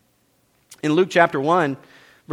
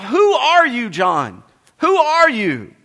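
high, loud demanding questions; pointed interrogation, pitched up; questioning priests; 10 semitones higher, 6 dB louder, slower than usual. Acted. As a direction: press him like suspicious priests. Priests pressing John.

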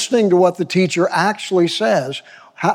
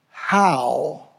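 a single How, set apart by silence; stress on How; 4 dB quieter than usual. Shown as a demonstration. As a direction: let How stand alone and weighty. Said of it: "I place an emphasis on that word".

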